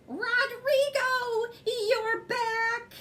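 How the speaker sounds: put-on high, slow, sing-song old lady calling; sing-song cry of an old lady. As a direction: greet the moose like a delighted old lady, singing the name. Old lady greets the moose.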